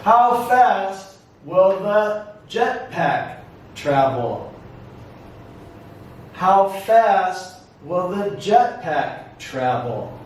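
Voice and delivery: slow question in separated chunks; careful classroom model for repetition; repeat-after-me model. Shown as a demonstration. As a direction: pause after "fast" and "jetpack". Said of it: "Repeat after me".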